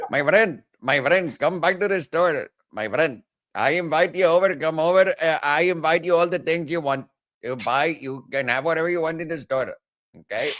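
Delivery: high-pitched mock Indian accent. Shopkeeper inviting a friend back, mock Indian accent. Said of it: "That sounds a little Indian".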